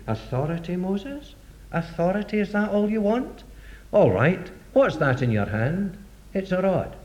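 God's soft reply. Acted. God answers Moses gently.

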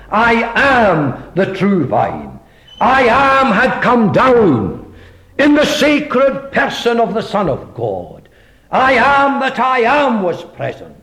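high, rising preacher's proclamation; preaching. Acted. Preacher proclaiming God's presence to the congregation, fervent. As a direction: proclaim it to the hall with swelling fervour.

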